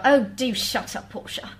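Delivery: very proper British English; posh British accent. Acted. Singer demonstrating a British person being very proper, posh British accent. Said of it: "or being very proper".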